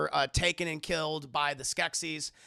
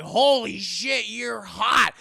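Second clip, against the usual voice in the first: loud, slow, awestruck blurt; smitten exclamation; 4 semitones higher, 9 dB louder, much slower than usual. Retold or imitated. Jen sees the girl Gelfling for the first time, loud slow awestruck blurt. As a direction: blurt it out, thunderstruck.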